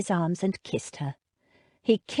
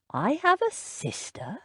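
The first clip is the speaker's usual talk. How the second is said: wondering; 6 semitones higher, 3 dB quieter than usual. A narrator's reading. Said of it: "in a wondering voice".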